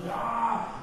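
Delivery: sudden high yell imitating revving up; a burst of noise like psyching yourself up; mock roar. Actor revving up in corner.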